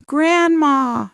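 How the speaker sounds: put-on high, loud joyful call of a child; excited cry. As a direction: shout it out with glee as she runs. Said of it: "she cried".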